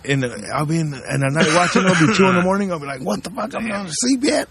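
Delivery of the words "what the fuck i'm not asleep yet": shocked disbelief. Retold or imitated. Retelling own late-night shock.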